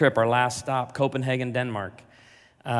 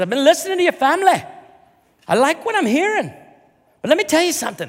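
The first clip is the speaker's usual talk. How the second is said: high, loud, hammed-up Irish accent; drunk Irish lady accent; 9 dB louder, faster than usual. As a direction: play the tipsy Irish woman in the pub. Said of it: "I have a hard time keeping the accent down".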